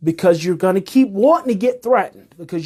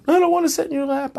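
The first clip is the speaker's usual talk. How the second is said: higher, fast whiny refusal; sulky toddler; 10 semitones higher, faster than usual. Acted. Coach plays the upset adult refusing comfort, toddler whine. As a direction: pout it out like a cranky kid. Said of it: "acted adult sulking like toddler".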